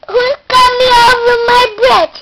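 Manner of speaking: high, loud troll demand. Acted. Child as troll demanding who crosses, loud and high. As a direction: boom it out like a bridge troll.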